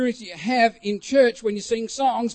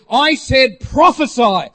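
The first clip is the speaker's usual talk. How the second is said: loud, slow retold command; slow, forceful imitation of a leader's command; 3 semitones higher, 7 dB louder, slower than usual. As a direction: give the order with full authority. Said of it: "and with a command".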